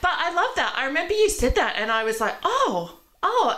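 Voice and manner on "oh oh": high, wondering oh; a realization dawning, pitched high; surprised. Retold or imitated. Reacting to friend's words.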